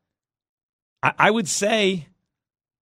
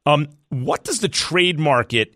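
ordinary talk, nothing marked

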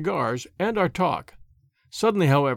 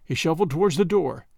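ordinary talk, nothing marked

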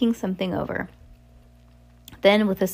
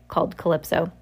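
plain reading voice

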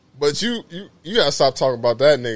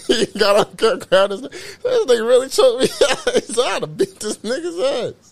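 high-pitched laughing through words; cracking up mid-sentence; 12 semitones higher than usual. Real, not acted. Laughing while telling choke story.

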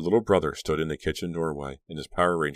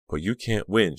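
ordinary delivery

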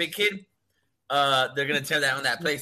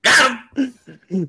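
triumphant; 8 semitones higher, 7 dB louder than usual. Real, not acted. Triumphant after the tease.

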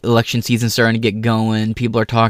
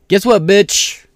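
higher, louder taunt at the HR lady; defiant shout; 8 semitones higher, 6 dB louder, much slower than usual. Real, not acted. Speaker taunting the HR head who threatened firing, loud and triumphant. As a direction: spit it back in triumph.

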